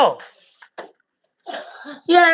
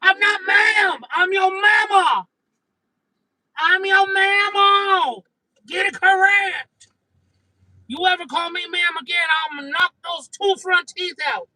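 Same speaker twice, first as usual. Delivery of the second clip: yelling mom; 5 semitones higher than usual. Acted. Mom yelling at her daughter for calling her ma'am. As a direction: bark it like an offended mama.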